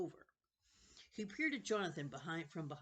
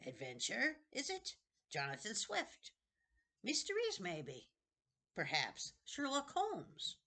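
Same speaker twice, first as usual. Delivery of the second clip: put-on high, eerie old man's voice; a wheedling ancient keeper of books; creepy old librarian; 5 semitones higher than usual. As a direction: play a strange, eager old librarian. Librarian character voice.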